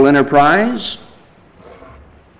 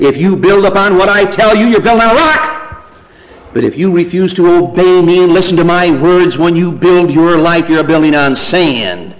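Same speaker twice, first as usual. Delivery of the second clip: raised, ringing sermon quote; forceful preaching; 8 semitones higher, 6 dB louder than usual. Acted. Preacher quoting the Lord Jesus to a congregation, forceful preaching. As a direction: preach it out as a bold warning.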